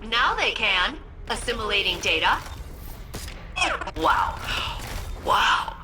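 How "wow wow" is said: excited shout. Acted. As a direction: shout it in awe. Awed at discovery.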